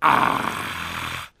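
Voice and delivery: long breathy "ah"; disappointed sigh. Shown as a demonstration. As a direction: exhale a mock-disappointed sigh into the microphone. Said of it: "that's how you sigh when you are disappointed".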